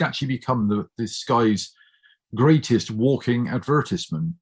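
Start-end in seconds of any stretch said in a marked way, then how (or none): none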